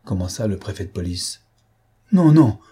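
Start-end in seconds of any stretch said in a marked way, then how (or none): 2.1-2.6 sharp, high, much louder cry of denial; furious cry. A narrator's reading. Accused widow cries out in fury at the police prefect. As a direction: hurl the no out in a burst of rage. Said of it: "s'écria-t-elle, prise d'un accès de fureur".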